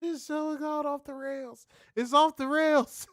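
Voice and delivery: high, squealing disbelief; laughing disbelief. Real, not acted. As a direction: squeal it out in mock shock. Shrieking at co-host's rant.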